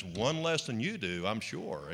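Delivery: lightly voiced wife, low and quiet; a soft, dropped-down aside as the lowly wife. Wife deflating her vain preacher husband, quietly lowly. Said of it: "the lowly wife says".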